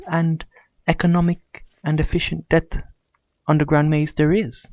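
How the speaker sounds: ordinary talk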